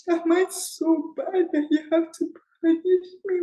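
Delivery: real tears rising as she relives it; getting emotional. Real, not acted. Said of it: "I'm still getting emotional".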